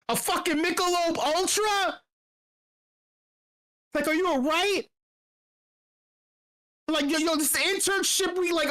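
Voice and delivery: high-pitched retold disbelief; incredulous. Asking the kid if he's okay, incredulous. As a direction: question him in high, stunned exasperation.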